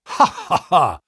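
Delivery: high, spoken-out ha-ha-ha; acted laugh. A narrator's reading. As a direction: laugh theatrically as a confident agent. Visitor laughs in the story.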